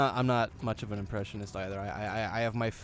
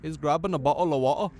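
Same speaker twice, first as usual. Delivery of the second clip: teasing, high British "bottle of water"; mock British accent; 4 semitones higher, 3 dB louder than usual. Teasing his British friend, exaggerated British accent. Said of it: "live stream suddenly got a lot less British".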